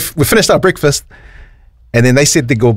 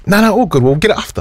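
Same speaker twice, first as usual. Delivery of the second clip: higher-pitched imitation of an easygoing mate; breezy brush-off; 8 semitones higher than usual. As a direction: wave off the worry, voice lifted. Retold friend brushing off worry.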